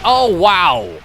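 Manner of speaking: loud, high burst of surprise; startled amazement. Real, not acted. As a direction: exclaim it, taken aback. Reacting to a blast in game.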